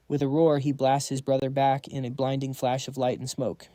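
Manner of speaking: ordinary delivery, nothing marked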